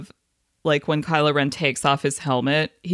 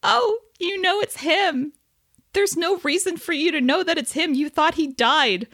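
fangirl voice; 13 semitones higher than usual. Retold or imitated. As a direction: coo it like a shipper. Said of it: "and I'm like".

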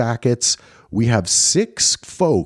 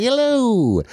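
mock high-pitched hello, much higher than usual; imitating her high voice; 12 semitones higher than usual. Host teasing Jenny about her greeting, mock high hello. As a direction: squeak it up the way she just said it. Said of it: "Just like".

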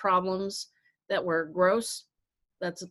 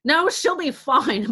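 slow, loud, higher brush-off; dismissive mom; 7 semitones higher, 6 dB louder, slower than usual. Retold or imitated. Mom refuses to come help with the dog, loud and slow. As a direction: wave it away like a stubborn mom. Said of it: "my mom's like".

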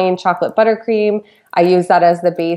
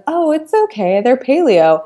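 higher, breezy excuse to herself; self-excusing; 7 semitones higher than usual. Retold or imitated. Justifying eating holiday cookies, a higher breezy excuse. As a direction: talk yourself into another cookie. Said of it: "And I was like".

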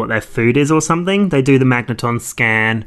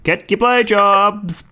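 slow, calling out a sale; town crier; 7 semitones higher, slower than usual. Retold or imitated. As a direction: cry it out like a street vendor. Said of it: "like a town crier".

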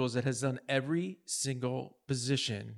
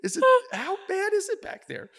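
much higher and louder, startled; alarmed surprise; 7 dB louder than usual. Retold or imitated. Manager asking how bad it is in the fudge shop, startled. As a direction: jolt up in alarm. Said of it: "And I was like".

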